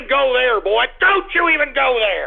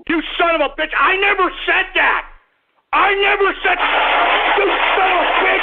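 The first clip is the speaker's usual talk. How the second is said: furious yelling; 6 semitones higher, faster than usual. Acted. Host yelling at prank callers, furious outraged shout. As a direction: scream your denial, betrayed and livid.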